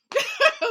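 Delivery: quick high, loud laugh. Real, not acted. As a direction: let a laugh burst out. Laughs after naming Mississippi.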